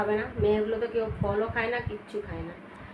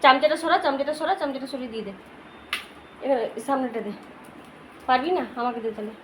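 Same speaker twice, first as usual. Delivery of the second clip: talking to a pet; 8 semitones higher, 10 dB louder than usual. Real, not acted. Feeding and commanding the pet dog.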